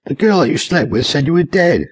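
high-pitched, incredulous explanation; shocked. A narrator's reading. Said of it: "said a shocked Charles".

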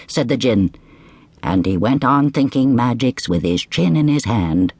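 usual speaking voice

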